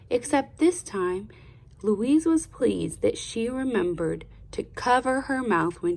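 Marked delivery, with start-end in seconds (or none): none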